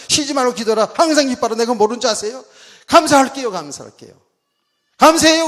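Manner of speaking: loud, high mock-exasperated retort; crooked, defiant compliance. Preacher re-enacting grudging thanks to God, defiant. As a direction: rattle back thanks like a sulky child, acted out. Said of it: "그 얼마나 삐딱하면 내가 그랬다니까".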